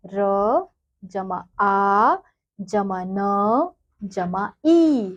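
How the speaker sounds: syllable by syllable, very slow; slow spelling out. Shown as a demonstration. Teacher to children spelling a word, very slow. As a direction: sound out each letter slowly for the kids. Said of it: "सबसे पहला शब्द क्या है?".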